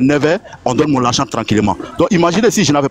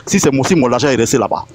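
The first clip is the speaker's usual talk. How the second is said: normal talking voice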